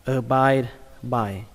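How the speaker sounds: "abide by" said slowly and clearly; slow model word. Shown as a demonstration. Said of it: "Các bạn đọc là".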